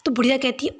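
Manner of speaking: usual speaking voice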